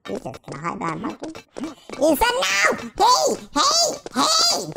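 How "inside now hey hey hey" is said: high-pitched, insistent calls; sharp calling out. Pretend. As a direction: call out high, climbing with each hey. Calling out a warning.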